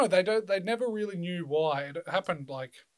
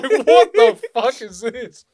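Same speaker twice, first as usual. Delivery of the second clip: loud, high, drawn-out disbelief; incredulous outburst; 8 semitones higher, 17 dB louder, much slower than usual. Retold or imitated. Retelling a friend's dog story to a mate, incredulous outburst. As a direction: stretch it out in loud, amused disbelief.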